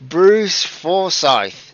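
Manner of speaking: high and loud, drawn out slowly; loud slow announcement, the wrong name; mistaken name. Real, not acted. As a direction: announce the wrong winner with gusto. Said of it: "No, it's not Bruce Forsyth".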